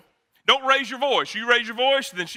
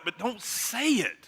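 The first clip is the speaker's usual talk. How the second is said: hushed warning; 7 dB quieter, slower than usual. Real, not acted. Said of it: "advice to men".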